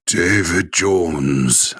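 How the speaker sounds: put-on slow, drawn-out Welsh accent. Host voicing a Welsh name in a slow Welsh accent. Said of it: "say Welsh names in a Welsh accent".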